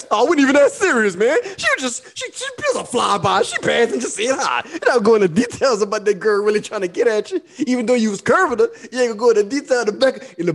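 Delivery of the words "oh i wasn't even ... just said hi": high, breezy denial with a laugh; mock innocent. Husband brushing off the flirt, high innocent voice. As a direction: play it off innocently. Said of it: "And you be like".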